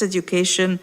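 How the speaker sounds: usual speaking voice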